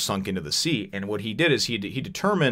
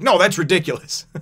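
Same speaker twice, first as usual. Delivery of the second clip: scoffing dismissal; 10 semitones higher, 8 dB louder than usual. Retold or imitated. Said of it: "he's just like".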